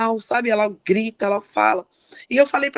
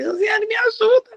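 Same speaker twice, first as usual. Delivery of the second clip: high-pitched retold call for help; 11 semitones higher than usual. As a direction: call out to a helper, pitch jumping up. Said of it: "eu já grito a Josiane aqui". (chamando por socorro, em tom agudo)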